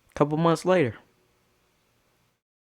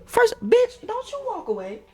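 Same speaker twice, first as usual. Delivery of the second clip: calling her back. Retold or imitated. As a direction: squeal the challenge and let it fall. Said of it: "I said".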